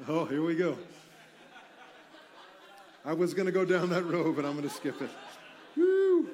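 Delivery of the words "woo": high, loud joyful whoop; a sudden high cheer of delight; excited whoop. Real, not acted. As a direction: let out a celebratory woo. Preacher cheers himself on.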